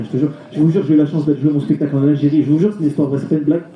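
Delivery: ordinary delivery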